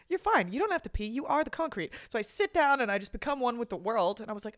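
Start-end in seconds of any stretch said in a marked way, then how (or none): 0.1-1.9 lightly voiced rabbit, higher pitched; little rabbit dude. Rabbit soothing someone who needs to pee, lightly voiced. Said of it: "little rabbit dude that was just like".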